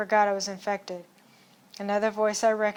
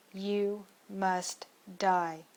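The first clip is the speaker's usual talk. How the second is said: slow cold verdict; much slower than usual. Acted. Aiming at the leader.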